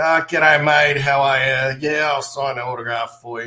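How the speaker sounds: loud, hammed-up Aussie mate voice; mock the ocker wrestler greeting fans; exaggerated Australian accent, loud; broad Aussie greeting. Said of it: "they'd go up to them and go".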